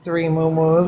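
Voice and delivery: normal talking voice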